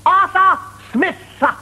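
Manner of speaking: a prissy superior fussing over th; hammed-up pompous boss. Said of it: "He said".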